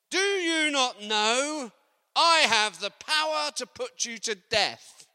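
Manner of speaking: loud, slow, menacing shout; Pilate's threat. Acted. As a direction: roar it like a ruler threatening death. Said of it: "and said to him".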